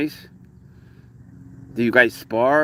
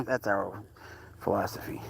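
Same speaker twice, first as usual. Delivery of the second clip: normal talking voice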